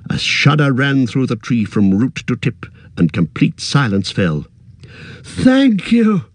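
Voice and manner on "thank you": hobbits' thanks. Acted. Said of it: "said the hobbits".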